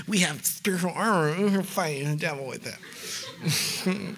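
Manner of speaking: soft, put-on kids' church voice; hammed-up Sunday school sing-along cheer; mock children's ministry. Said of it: "not to get super children's ministry on us".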